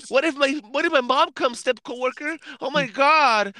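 high, fretful imitation, worried about mom; teasing impression of a nervous coworker; mock panicked whisper-worry. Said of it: "then he's like".

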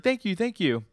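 high-pitched mock gushing; overjoyed gratitude. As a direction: imitate a thrilled pupil thanking the teacher. Shocked, praised pupil thanking her sensei.

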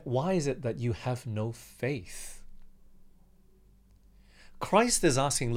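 normal talking voice

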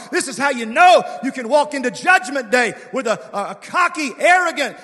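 loud, high, rising preacher cadence; fiery revival sermon; full-force preaching. Acted. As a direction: push the church to its feet.

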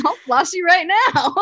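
high, loud quoted threat; loud mock threat. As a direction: belt the threat out. Quoting favorite line.

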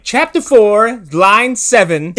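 high, loud, slow with a laugh; slow, amused mock recital of a page reference; mock reading aloud. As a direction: spell it out like a stiff reciter. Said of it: "whereas before he was like".

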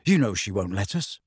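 heavy. A narrator's reading. Boy to friend hoping to copy homework, heavy. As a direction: point it out heavily. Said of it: "he said heavily".

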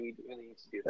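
soft, dropping imitation of Jalen; Jalen Rose impression. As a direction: mimic the analyst, trailing off softly. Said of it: "That was a, that was a pretty good Jalen".

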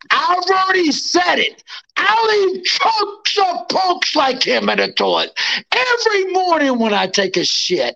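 screamed fighter threat; hyped shouting. Real, not acted. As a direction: roar it like a fight promo. Fighter's trash talk promo.

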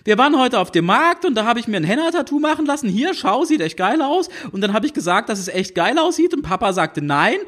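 put-on high, fast teenage babble without a pause; high-pitched teenage girl rattling off her story; breathless rush. A narrator's reading. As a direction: gush it all out in one breath. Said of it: "ohne Punkt und Komma".

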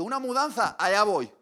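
high, loud eager cry; eager volunteering. Retold or imitated. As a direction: jump at the chance to get out of the house. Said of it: "Y dije". (entusiasmo fingido y exagerado)

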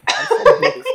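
shrieking shut up through laughter; laughing shout. Real, not acted. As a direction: yell it while cracking up.

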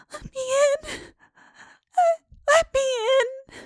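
put-on sobbing ghost child. Ghost of Catherine begging at the window, high sobbing wail. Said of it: "the most melancholy voice sobbed".

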